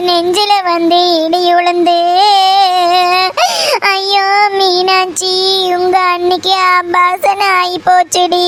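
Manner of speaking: slow, chant-like tearful keening; crying lament. Acted. Mother breaking news of a miscarriage, crying lament. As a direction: stretch each line's end into a sob. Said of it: "சொல்லிட்டு அழுமா".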